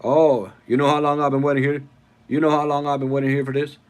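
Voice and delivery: loud, quick movie-hero imitation; Gambit. Streamer quotes a Cajun film hero for viewers, imitated voice. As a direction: rattle it off loudly in character. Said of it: "take a listen to Gambit here".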